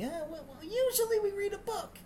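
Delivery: put-on very high, small child's voice; kid voice. Kindergartner telling the new teacher. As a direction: squeak it out like a little kid.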